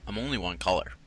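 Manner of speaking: ordinary delivery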